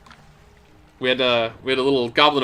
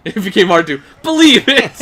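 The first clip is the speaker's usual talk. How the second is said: laughing at own line; cracking up mid-sentence; 5 semitones higher, 8 dB louder than usual. Real, not acted.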